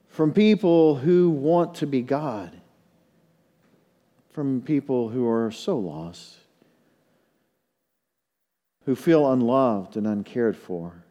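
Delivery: pastoral prayer. Real, not acted. Pastor leading congregation in prayer, hushed earnest prayer. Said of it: "Let's pray".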